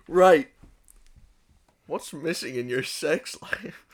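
ordinary delivery

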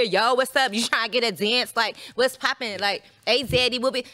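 blunt, unsexy dance hustle; brash hustling dancer. Shown as a demonstration. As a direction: pitch a dance loudly, no seduction. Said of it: "They don't wanna hear".

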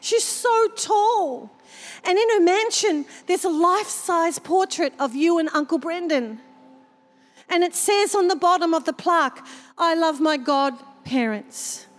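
put-on high little girl, slowing down in awe; a small girl describing heaven, wide-eyed; child's voice. Girl describing Cheryl's mansion to Auntie Kathy, high and awed. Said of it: "She said".

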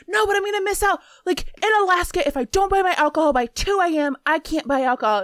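whiny yelling Alaskan shopper; imitating customer yelling. Retelling the customer protesting at the register, mock yell. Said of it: "So he starts screaming at me".

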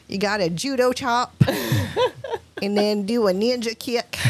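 put-on country ninja teacher with a thick Southern accent. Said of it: "super heavy Southern accent".

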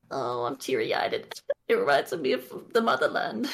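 mock teary-eyed. Mimicking the soldiers moved by her song, mock teary-eyed. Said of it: "and everyone's like".

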